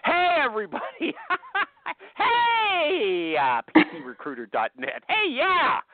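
squealed, high-pitched ad greeting with a laugh; mock hype opener. Host launching her sponsor ad, high and gushing. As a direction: kick off the sponsor read like a gushing announcer. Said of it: "I'm gonna do my ad now".

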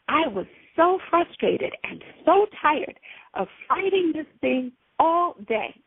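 ordinary talk, nothing marked

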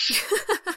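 high, hearty burst of laughter; uproarious laugh. Acted. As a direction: Stella laughing at her own fall, uproarious. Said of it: "Stella laughed uproariously".

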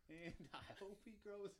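giggly, squirming while tickled; being tickled. Acted. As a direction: talk while someone tickles you. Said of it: "Stop tickling me".

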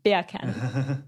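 "Beer can" in a British accent, r dropped so it sounds like "bacon". Shown as a demonstration. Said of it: "so in a British accent, everyone should try to say beer can".

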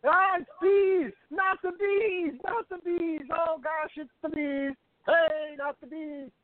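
high, slow panicked yelling; hammed-up terror of bees; yelling about bees. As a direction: scream about the bees like Nicolas Cage. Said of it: "just start yelling about bees".

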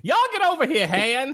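very high, comic country-style holler; hammed-up folksy Leia. Host topping the joke, Leia calling Han over, hammed-up high. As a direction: parody the princess as a squeaky yokel. Exaggerates Leia's lost accent, folksy.

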